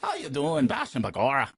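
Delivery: hammed-up Irish accent, opening on a high cry; happy drunk Irish. Shown as a demonstration. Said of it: "they're happy. And so it's".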